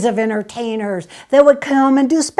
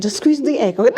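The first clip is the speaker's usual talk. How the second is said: ordinary talk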